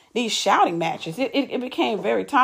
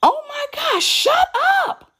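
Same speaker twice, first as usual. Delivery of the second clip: much higher, louder mock shout; mimicked shout; 3 dB louder, slower than usual. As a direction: snap it out like she's yelling at you. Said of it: "literally shouts at me".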